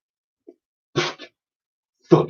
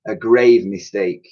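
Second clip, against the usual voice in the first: plain reading voice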